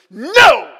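put-on a child's sudden loud, high "No!"; mock outburst of a tiny daughter; shouted no. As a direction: yell it like a scared little girl. Daughter refuses the spanking.

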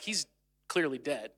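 hushed aside. Retold or imitated. The people whispering about Moses, a hushed aside. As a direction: drop low and soft, as if it's a secret.

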